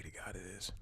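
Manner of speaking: soft unvoiced whispered prayer; whispering. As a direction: whisper it like a secret hope. Aside prayer after checking camera.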